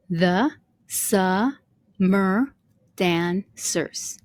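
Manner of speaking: "the summer dancers" broken into separate syllables with pauses. Shown as a demonstration. Teacher checking the syllables of line number one, syllable by syllable. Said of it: "let's check this out".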